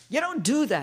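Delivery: sharp loud scolding; loud reprimand from the meeting guy. Retold or imitated. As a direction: snap it like a stern old-timer.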